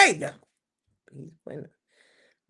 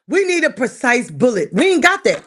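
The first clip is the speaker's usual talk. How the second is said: louder, blunt declaration; loud complaint; 10 dB louder than usual. Real, not acted. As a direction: lay it down loud.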